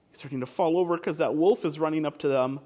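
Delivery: usual speaking voice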